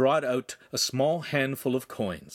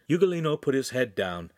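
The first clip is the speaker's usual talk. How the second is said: ordinary delivery, nothing marked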